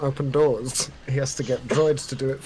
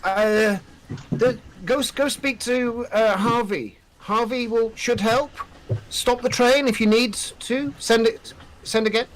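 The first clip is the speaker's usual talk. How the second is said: higher, urgent officer voice; Major Taff; 7 semitones higher than usual. Acted. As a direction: rush the warning through a crackly link. GM voices Major Taff's reply.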